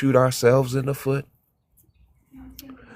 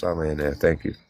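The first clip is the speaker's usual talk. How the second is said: normal talking voice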